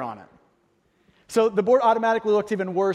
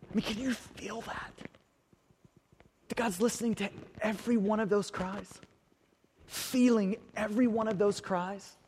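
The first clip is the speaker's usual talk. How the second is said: hushed preaching; 6 dB quieter than usual. Acted. Intimate quiet preaching.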